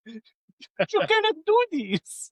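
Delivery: high, slow imitation of a German granny; German grandma. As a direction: scold like a hammed-up German grandmother. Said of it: "That's my grandma. She's German".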